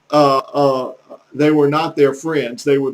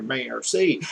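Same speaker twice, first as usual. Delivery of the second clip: "merci" in a hickish country accent; "merci" lower and softer, with a country accent; 4 semitones lower, 6 dB quieter than usual. Shown as a demonstration. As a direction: say "merci" with a Southern country twang. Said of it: "I heard my own country accent there".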